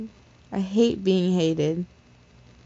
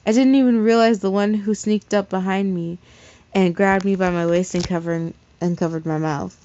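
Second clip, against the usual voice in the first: usual speaking voice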